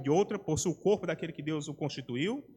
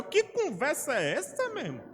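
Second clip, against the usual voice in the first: shocked retort; 6 semitones higher than usual. Retold or imitated. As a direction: recoil at the offer as if you can't believe it. Pastor's shocked reply to an indecent offer.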